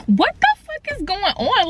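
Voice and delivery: high-pitched mock-shocked outburst; retold disbelief. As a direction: act out a stunned "what is happening". Retold shocked reaction to shooting.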